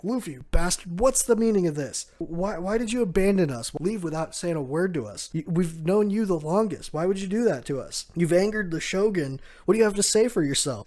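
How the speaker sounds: hammed-up outraged demand for answers; acted indignation.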